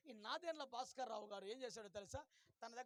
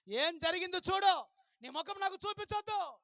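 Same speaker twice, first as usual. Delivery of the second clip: angry principal; 5 semitones higher, 11 dB louder than usual. Retold or imitated. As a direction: throw her out of the room. Principal rejects the failed girl. (Principal కోపంగా గట్టిగా)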